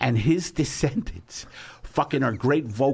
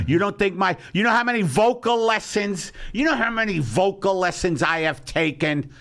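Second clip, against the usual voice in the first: indignant outburst; 7 semitones higher than usual. Acted. Host defends his vocal training to critic, indignant shouting. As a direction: protest at the top of your pitch, wounded pride.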